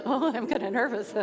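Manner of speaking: giggly and jittery; nervous laugh. Shown as a demonstration. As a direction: giggle through nerves. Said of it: "Okay, your turn".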